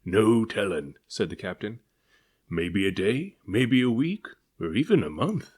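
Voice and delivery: put-on old captain grumbles on becalmed deck; growling captain. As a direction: growl out your dismay. Captain voiced.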